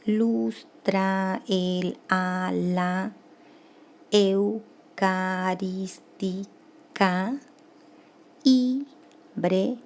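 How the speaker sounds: drawn-out syllables with gaps between. Shown as a demonstration. Teacher counting grammatical syllables for the class, syllable by syllable. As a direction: sound out each syllable slowly and separately. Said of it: "trece sílabas gramaticales".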